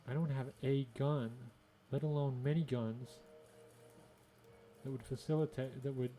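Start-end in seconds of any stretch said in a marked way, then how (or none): none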